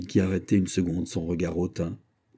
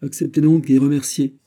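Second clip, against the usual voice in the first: higher and louder, firm command; insisting; 7 semitones higher, 8 dB louder than usual. Acted. The queen mother insisting the king accept and thank. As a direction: push him to accept. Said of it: "insista Anne d'Autriche".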